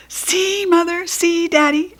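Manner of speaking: high, thrilled calling out; child calling proudly across the pool. Acted. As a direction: call to parents from the pool. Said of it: "I called".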